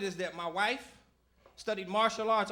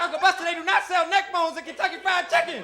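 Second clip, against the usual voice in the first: exasperated protest; 8 semitones higher, 6 dB louder than usual. Retold or imitated. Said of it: "I'm like".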